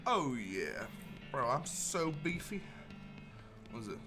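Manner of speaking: ordinary delivery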